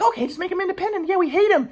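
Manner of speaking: very high mocking mom voice. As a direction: squeak out mom's cold agreement. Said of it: "my mom's like".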